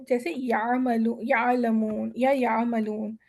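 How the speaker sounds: یعملون slow, soft throaty ayn with no catch. Shown as a demonstration. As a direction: ease یعملون out of the throat gently. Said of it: "عین کی آواز نرم، soft اور بغیر جھٹکے".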